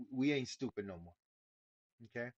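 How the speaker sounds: ordinary talk, nothing marked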